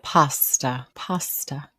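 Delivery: "Pasta" with open AH; pronunciation coaching. Shown as a demonstration. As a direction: say "Pasta" as a careful PAH-stuh. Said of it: "Just say it with me".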